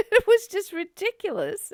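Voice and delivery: high, loud laugh into words; real amused laugh. Real, not acted. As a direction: laugh at how silly it was. Laughs at ridiculous cause.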